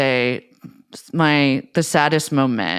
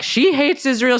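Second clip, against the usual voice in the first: Donald Trump; 9 semitones higher, 3 dB louder than usual. Retold or imitated. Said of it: "And Trump is like".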